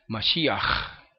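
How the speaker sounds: "Mashiach" with a lengthened rasped kh; slightly exaggerated ending. Shown as a demonstration. Teacher exaggerating so it is noticed, rasped ending. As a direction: lean on the final throat scrape of "Mashiach". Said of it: "Yo exagero un poco".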